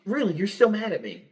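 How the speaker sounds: imitating wife. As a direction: act out his wife's hurt disbelief.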